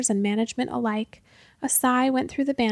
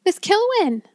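high, loud shriek of a name; panicked shriek; 8 semitones higher, 4 dB louder than usual. A narrator's reading. Man calling his colleague as a dragon looms, panicked shriek. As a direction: shriek for help as the character. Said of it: "shrieked Hackenpfeffer again".

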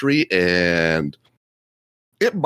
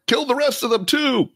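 much higher yelled command; angry mob leader. Acted. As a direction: call it out like a raider chief. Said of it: "It's like".

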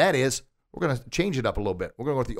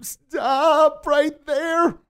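very high, drawn-out "Stop right there"; hammed-up ineffective warning; mock uncourageous demo; 6 dB louder, much slower than usual. Shown as a demonstration. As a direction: stretch it out slowly in a silly high pitch. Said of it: "You've gotta say it courageously".

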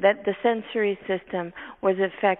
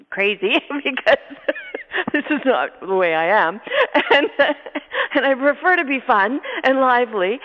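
real laughter breaking through the words; amused at yourself, loud and laughing; 5 semitones higher, 7 dB louder than usual. Real, not acted. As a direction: talk through laughter, getting louder and livelier.

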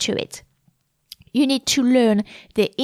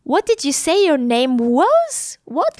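slow, higher, rising disbelief; incredulous questioning; 4 semitones higher than usual. Acted. Teacher acting out what the rising question implies, incredulous. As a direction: ask as if the name sounded weird or funny. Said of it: "it's weird or it's funny".

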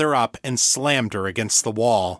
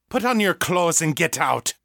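restrained, higher-pitched narrated shout; shouted order; 7 semitones higher than usual. A narrator's reading. Mother ordering two men out of her flat, shouted. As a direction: order them out, raised pitch without much volume. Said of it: "Mother shouted".

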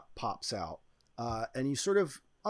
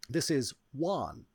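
normal talking voice

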